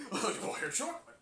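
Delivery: a lightly voiced manic buyer; crazy chocolate guy. Fan imitating the chocolate guy, lightly voiced. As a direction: lean in like a stalker. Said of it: "the crazy chocolate guy".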